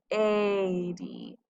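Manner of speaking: "lady" with the A drawn out as "ay"; long A sound. Shown as a demonstration. Teacher showing the long A in "lady", stretched vowel. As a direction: stretch the first vowel of "lady": laaay-dee. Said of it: "notice how I hear that long A sound".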